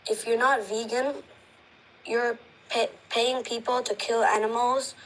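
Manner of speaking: ordinary talk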